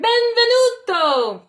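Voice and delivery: high, loud crowd cheer. A narrator's reading. Crowds cheering Tony into Milano. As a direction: hail him like a cheering crowd. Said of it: "The crowds cheered".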